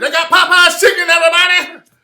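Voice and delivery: high, very loud shouted hype-man voice; screaming crispy character voice; crispy voice yell. Retold or imitated. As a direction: bellow it like a hyped-up character who won't quit. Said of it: "wouldn't stop doing the crispy voice".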